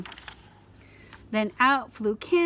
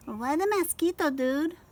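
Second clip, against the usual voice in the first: small dog voice; 6 semitones higher than usual. A narrator's reading.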